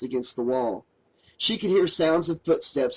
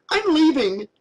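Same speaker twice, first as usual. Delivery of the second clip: much higher, louder declaration; leaving; 13 semitones higher, 5 dB louder than usual. A narrator's reading. Mary leaping to her feet to leave Josh, loud and high. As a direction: announce it as you bolt.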